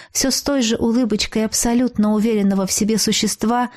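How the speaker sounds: plain reading voice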